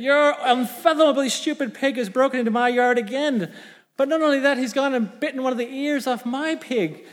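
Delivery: high comic farmer's rant; mock outrage. Farmer reports the bitten ear to neighbour, mock furious. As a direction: rail at the neighbour, overplaying it. Acted complaint about neighbour's pig.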